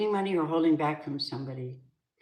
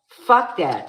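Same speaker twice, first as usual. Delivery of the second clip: escalated angry "Fuck that", the louder second take; louder angry repeat; 3 semitones higher, 8 dB louder than usual. Real, not acted. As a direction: slam "Fuck" harder the second time.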